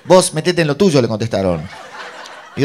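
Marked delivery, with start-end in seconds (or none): 0.0-1.0 high, loud mock rebuff; snappy retort. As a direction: tell off a meddler, in imitation. Le contestan en la riña. (réplica cortante imitada, fuerte)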